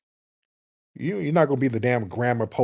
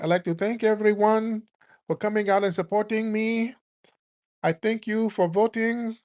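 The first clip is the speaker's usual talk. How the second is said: high mocking imitation of a politician's Latin accent, pitched well up; 9 semitones higher than usual. Mocking Kamala's Latin accent at a rally for Latinos. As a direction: play up a put-on Latin accent for a rally crowd. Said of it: "talking in a damn Latin accent".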